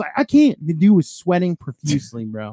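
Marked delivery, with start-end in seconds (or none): 0.2-0.6 high, loud imitated protest; overwhelmed retold cry. Sweating removal guy wanting to quit, overwhelmed. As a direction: act out someone giving up in a panic.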